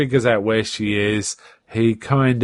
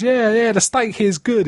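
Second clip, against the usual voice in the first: faking bad line; 10 semitones higher than usual. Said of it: "He goes".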